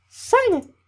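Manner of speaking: put-on very high girl voice; Slavia voice. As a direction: squeal it like a bright camp girl. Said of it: "Slavia took the paper".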